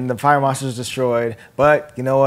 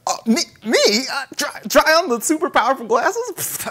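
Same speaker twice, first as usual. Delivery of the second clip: lightly voiced Peter, higher and falsely modest; mock surprised Peter; 10 semitones higher than usual. Retelling Peter trying on glasses, mock surprised. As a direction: play coy, pretend amazed. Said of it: "And he's like".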